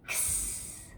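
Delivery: whispered X like a kiss. Acted. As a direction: breathe X softly as a kiss. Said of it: "and says".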